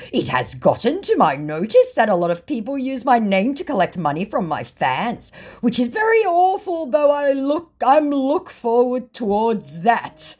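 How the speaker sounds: hammed-up scammer read; mock scammer voice. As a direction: read his broken English like the scammer. Reading scammer message in voice.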